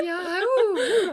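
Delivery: high, swooning "ooh"; mock swoon. Friend teasing her crush on Brad, high mock swoon. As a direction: sigh it like a smitten fan.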